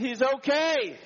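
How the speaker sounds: high, bursting cry of relief; father overjoyed his son is alive; joyful relief. Retold or imitated. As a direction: let the joy break out. Son found alive, praising God.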